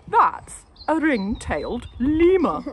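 high, loud, slow declaration; puzzled husband. A narrator's reading. Husband naming the lemur to his wife. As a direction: pronounce it with wonder. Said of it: "husband identifies lemur".

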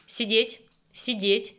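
loud firm sit command; dog command. Real, not acted.

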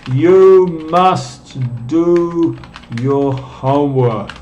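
slow, deliberate dictation pace. Shown as a demonstration. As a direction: spell it out slowly for the class to write. Teacher dictates an example.

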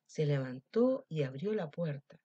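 plain reading voice

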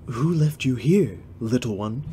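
lightly voiced elf with a faint put-on accent; tender hint of an elf prince; Legolas voice. Legolas gently asking the baby, lightly voiced. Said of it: "should I do, like, an accent?".

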